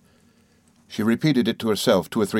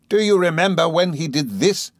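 furious accusation; 9 semitones higher than usual. Acted. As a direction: hurl it at the mother like a curse. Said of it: "she proceeded".

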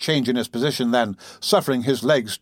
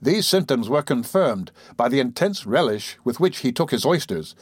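usual speaking voice

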